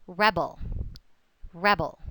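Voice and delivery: "rebel" with the first syllable stressed, REB-el. Shown as a demonstration. Teacher models the noun for the class, "rebel" stressed up front. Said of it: "That's the noun".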